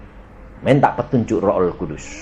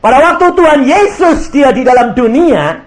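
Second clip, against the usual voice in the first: high, loud preaching over the congregation; full-force preaching; 12 semitones higher, 8 dB louder than usual. Acted. Preacher proclaiming to his congregation, loud and high. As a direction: proclaim it to the whole church.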